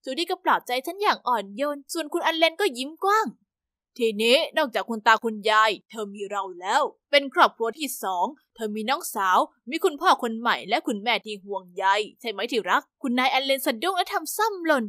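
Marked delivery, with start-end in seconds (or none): 4.0-12.8 lightly voiced kind father, lower; a hint of a welcoming dad; new father. A narrator's reading. New father welcoming the girl into family, lightly voiced.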